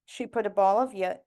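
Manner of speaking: ordinary delivery, nothing marked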